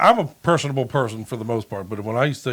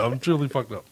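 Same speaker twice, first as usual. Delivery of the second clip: normal talking voice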